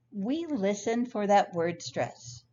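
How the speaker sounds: ordinary delivery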